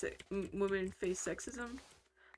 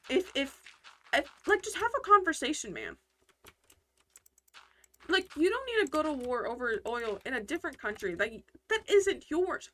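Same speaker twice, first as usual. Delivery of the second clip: higher, louder, pleading; exasperated plea; 6 semitones higher, 4 dB louder than usual. Real, not acted. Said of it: "okay, I can't say it".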